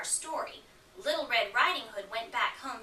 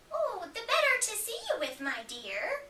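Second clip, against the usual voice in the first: wolf as granny; 11 semitones higher than usual. Acted. Wolf disguised answers Red.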